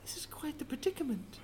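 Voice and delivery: hushed whispered aside; whispering. Acted. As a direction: whisper it calmly. Calm aside.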